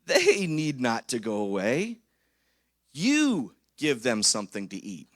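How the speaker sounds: slow, steady, deliberate; Jesus answering calmly. Retold or imitated. Jesus telling the disciples to feed the crowd, slowly. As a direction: lay each word down slowly with authority. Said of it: "But Jesus said".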